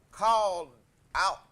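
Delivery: usual speaking voice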